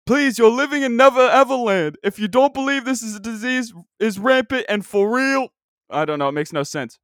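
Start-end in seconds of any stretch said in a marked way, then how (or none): 0.1-5.5 much higher and much louder, hollering like a courtside announcer; Dick Vitale impression. Podcaster mocks hoax believers in a sportscaster's hollering voice. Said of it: "This is my impression of Dick Vitale".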